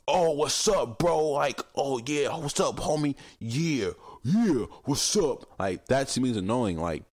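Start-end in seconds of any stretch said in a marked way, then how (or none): 0.1-5.6 higher, drawn-out mock bro greeting; fake bro talk. Mocking people who switch to bro talk with the speaker, higher. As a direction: put on a forced homie voice. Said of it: "they go from talking like that to be like".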